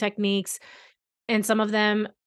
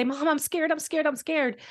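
higher, pleading mock panic of a frightened kid; imitating a panicked child; 7 semitones higher than usual. Mom retelling her son frozen on the playground, mildly imitating his panic. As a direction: echo the boy's frozen fear, pitched up. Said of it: "panic, freeze, and say".

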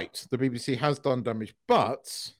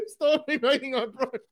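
mocking prince, laughing; 11 semitones higher than usual.

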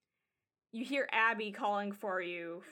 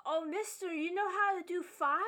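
put-on higher-pitched, eager child voice; excited kid; 8 semitones higher than usual. Kid asks mister about doing fire, eager child voice. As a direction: ask like an impressed kid.

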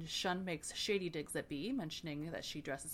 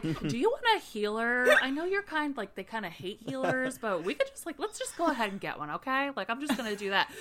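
mock fussing; 5 semitones higher, 7 dB louder than usual. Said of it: "he's like".

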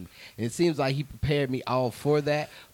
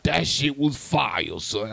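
put-on pitched up, pushy old man urging him on; hammed-up older man, higher and louder; older gentleman; 5 semitones higher, 7 dB louder than usual. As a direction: lean in like an insistent older guy hyping a young comic. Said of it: "He's like".

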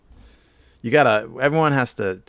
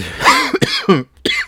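sudden loud hacking cough; coughing fit; 11 semitones higher, 7 dB louder than usual. Real, not acted. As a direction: cough hard, breaking off the sentence. Coughs mid-sentence, then apologizes.

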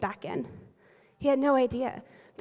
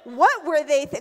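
drawn-out, hammed-up indignation; outraged confidant; 3 semitones higher, 5 dB louder, slower than usual. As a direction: play the friend who takes your side, loudly aghast. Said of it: "The first phone call when we're frustrated".

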